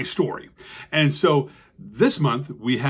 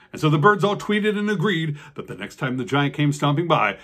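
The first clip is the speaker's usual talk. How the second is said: ordinary delivery, nothing marked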